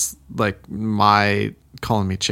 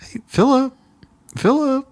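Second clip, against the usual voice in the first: put-on high, calling out for a hidden kid; sing-song calling Philip's name through the house; worried parents calling; 13 semitones higher than usual. Parents search the house calling for their hidden son, high and sing-song. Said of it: "And they're like".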